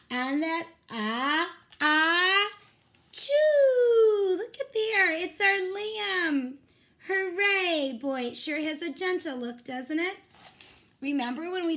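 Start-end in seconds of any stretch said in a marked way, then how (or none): 0.1-4.4 loud rising ah-ah-choo; hammed-up big sneeze; mock sneeze. Teacher acts the lion's sneeze for the kids, hammed-up. 4.4-8.0 delighted. Acted. Lamb appears in the story.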